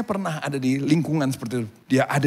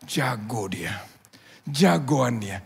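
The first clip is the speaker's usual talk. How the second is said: ordinary talk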